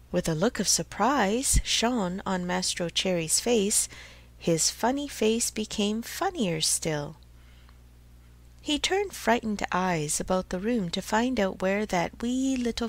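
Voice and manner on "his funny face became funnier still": ordinary delivery, nothing marked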